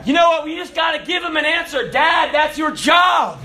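loud, high, fervent call to dads; rally the fathers to their job; preaching at full force. Acted. Preacher telling dads to teach their kids, fervent.

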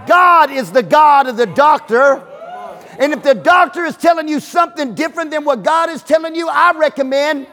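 loud, high sermon shout; fiery preacher building the point; preaching at full force. Acted. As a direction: hammer it home to the congregation.

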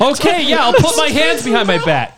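high, loud whiny mock suspect; mock him in a high whine.

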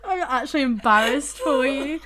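ordinary delivery

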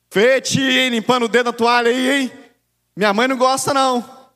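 put-on kid tattling loudly. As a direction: blurt it out like a nosy kid at the table. Child exposing aunt at lunch. (criança dedurando a tia em voz alta)

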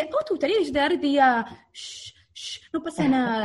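lightly mock the soothing "shh, shh, no pasa nada"; mocking imitation. Partner Agus mocking the mother's shushing, light mocking imitation. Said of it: "agarra Agustina y me dice".

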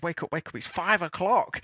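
put-on a six-year-old tugging at his uncle at dawn; excited little boy. Logan waking his uncle, high excited child voice. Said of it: "he says".

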